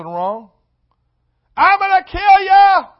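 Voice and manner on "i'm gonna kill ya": high, loud, slow mock yell; hammed-up angry parent yell; parent's threat. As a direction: shout it like a furious parent at kids. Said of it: "That's how they talk to us".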